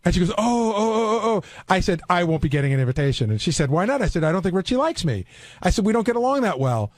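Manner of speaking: usual speaking voice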